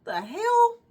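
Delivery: high, incredulous "the hell"; mock disbelief. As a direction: her inner voice snapping out of it. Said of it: "I heard my voice say".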